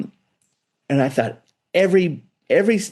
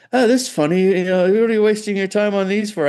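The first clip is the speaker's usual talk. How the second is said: higher mocking amusement at a cigar box guitar; dismissive shop owner; 9 semitones higher than usual. Shop owner teasing the young builder, amused and dismissive. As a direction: brush it off like a toy. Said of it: "And he goes".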